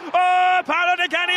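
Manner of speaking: loud, high cry of delight at the skill; excited shout. Real, not acted. Fan cheering a player's skill, loud excited shout. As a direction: shout it out over the crowd.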